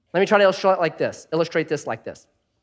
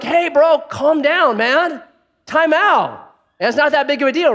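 higher, louder 'easy, bro' plea; mock calming intervention; 8 semitones higher, 4 dB louder than usual. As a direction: play the bystander cooling him down. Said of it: "Most of us minimally be like".

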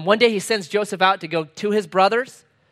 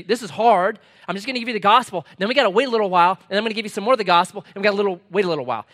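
fast, louder run-through of the advice; rushed counsel; 4 semitones higher, 4 dB louder, much faster than usual. Retold or imitated. Pastor acting out his advice to a suffering person, fast and brisk. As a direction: rattle it off like a pastor's routine.

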